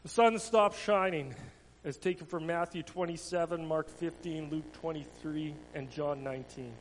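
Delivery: a dramatic storyteller's read; children's story reading. Acted. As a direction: tell it to the children with feeling. Tells the children's Passion story.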